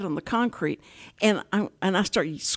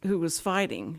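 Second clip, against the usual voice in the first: normal talking voice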